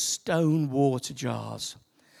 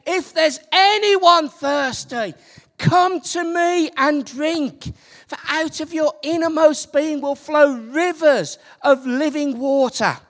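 much higher and louder, slow, ringing out; loud proclamation; 8 semitones higher, 9 dB louder than usual. Retold or imitated. Jesus crying out to the crowd in the temple, loud. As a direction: proclaim it across the whole temple. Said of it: "and in a loud voice he said".

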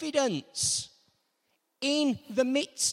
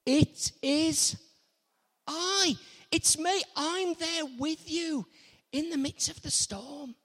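emphatic reassurance; 5 semitones higher than usual. Retold or imitated. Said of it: "the next thing he says".

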